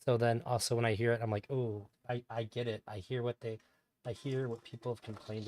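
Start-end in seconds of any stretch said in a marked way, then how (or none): none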